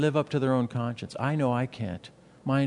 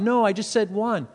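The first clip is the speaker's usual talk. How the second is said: higher-pitched acted objection; mock protest; 7 semitones higher, 4 dB louder than usual. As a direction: cut in like a questioner objecting. Acts out questioner's protest.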